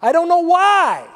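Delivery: high, loud mock whine; exasperated complaint. Preacher voicing a frustrated listener's complaint, high mock whine. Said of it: "You say".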